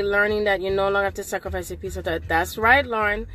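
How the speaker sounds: ordinary talk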